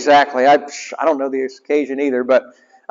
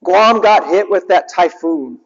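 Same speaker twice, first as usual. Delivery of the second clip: normal talking voice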